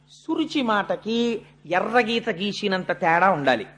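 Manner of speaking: normal talking voice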